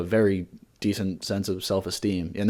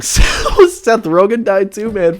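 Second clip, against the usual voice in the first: laughing through the words, pitched up; 10 semitones higher, 9 dB louder, much slower than usual. Real, not acted. Laughing to his co-host about Seth Rogen dying. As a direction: crack up mid-sentence in disbelief. Laughing at absurd dead list.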